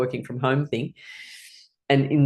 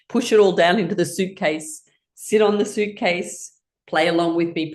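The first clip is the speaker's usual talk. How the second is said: ordinary delivery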